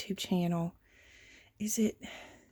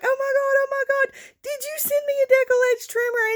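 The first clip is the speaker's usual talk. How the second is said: much higher and louder, gushing disbelief; excited squeal; 5 dB louder, faster than usual. Retold or imitated. Texting a friend who sent a gift, high thrilled squeal. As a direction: squeal like you can't believe the gift. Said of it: "I was like".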